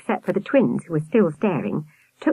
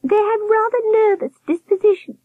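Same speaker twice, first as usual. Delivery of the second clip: high falsetto, not truly hushed; confiding nurse; 3 dB louder than usual. A narrator's reading. Nurse confiding about the old folk, high warble. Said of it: "the high-pitched voice".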